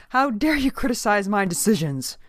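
loud, high indignant outburst; outraged cry. A narrator's reading. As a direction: cry out, affronted by the underling. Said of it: "cried the minister".

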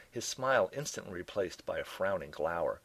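usual speaking voice

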